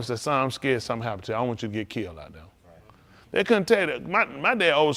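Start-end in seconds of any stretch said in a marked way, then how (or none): 0.2-2.4 a father's low, quick, softened plea, lightly voiced; worried father. Father confiding in his son, lightly voiced low worry. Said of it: "My dad could just told me".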